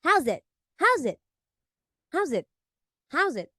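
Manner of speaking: unsure imitation of South African "howzit"; amateur South African accent, quick falling tune; attempted South African accent. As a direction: try a South African greeting, repeated. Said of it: "they usually go like this".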